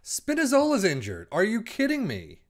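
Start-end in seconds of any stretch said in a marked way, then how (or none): 1.3-2.4 exasperated disbelief. Real, not acted. Second player injured, no subs.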